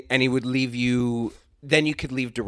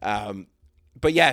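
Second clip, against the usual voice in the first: normal talking voice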